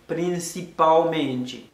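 "Prinsipalmente" slowly, as "prin-si-pow-MEN-chee"; syllable-final ou sound. Shown as a demonstration. Teacher giving a slow example, ou sound for l. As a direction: stretch "Prinsipalmente", its l turned to oo. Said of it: "la gen son ou".

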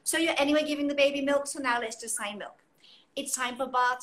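ordinary talk, nothing marked

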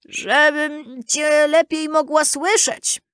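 high, slow put-on granny voice; wolf posing as grandmother. A narrator's reading. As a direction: draw it out high, as a disguised wolf. Wolf poses as grandmother answering.

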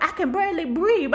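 normal talking voice

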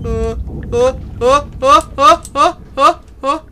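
quick string of high, frightened gasps; short loud uhs rising in pitch; rhythmic high whimpers of alarm; panicky grunts. Real, not acted. Frightened noises during monster scare.

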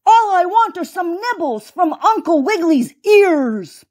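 high-pitched, greedy villain voice; bellowing alligator. A narrator's reading. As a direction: demand a snack as the gator. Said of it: "bellowed the alligator".

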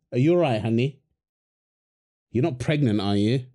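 normal talking voice

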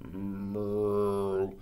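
big cow's long, low moo. Acted. Reader voices a big cow, low moo.

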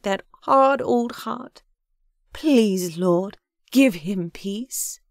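heartfelt, imploring prayer voice; fervent prayer. A narrator's reading. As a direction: pray earnestly for someone, drawing out the words. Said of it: "She ended the prayer".

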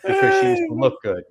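laughing so hard it comes out as a yelp; breaking into laughter. Real, not acted. Laughing outburst mid-reading.

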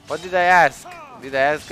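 loud frustrated yelling; angry shout. Real, not acted. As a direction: yell it out at the game. Angry rant at the game.

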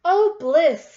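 loud, delighted sigh of joy; blissful cow. Acted. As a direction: sink into the bath with a big happy oh. Cow jumps happily into tub.

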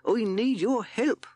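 high shout, the last words slow; old mole yelling for help from the wood. Acted.

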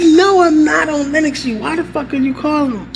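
annoyed at cousin's prank call; annoyed denial. Retold or imitated. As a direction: brush him off, fed up.

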